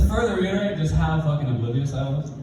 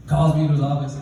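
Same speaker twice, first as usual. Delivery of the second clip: usual speaking voice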